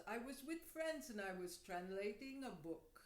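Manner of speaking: lilting imitation of Indian-accented English. Little man explains his evening to a stranger, Indian accent. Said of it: "he speaks with an accent".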